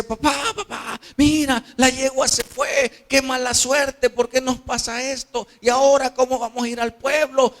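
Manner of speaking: high, urgent cry to papa; desperate son. Acted. Son telling his father the mare has run off, desperate. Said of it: "el joven desesperado le dice".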